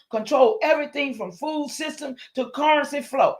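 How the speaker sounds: ordinary talk, nothing marked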